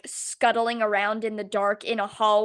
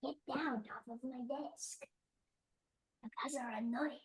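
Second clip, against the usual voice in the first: soft scolding; 2 semitones higher, 16 dB quieter than usual. Real, not acted. Scolding cat quietly.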